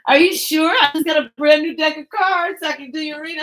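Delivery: high, loud sing-song pitch; card reader pressing a stranger, high and loud; pushy reader. Retold or imitated. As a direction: sell the reading brightly. Reader insisting on reading.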